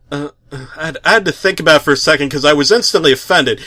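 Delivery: ordinary delivery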